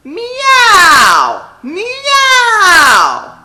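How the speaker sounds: high, loud meow like a cat; belted cat meow; exploding cat meow. Shown as a demonstration. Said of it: "really explode on it".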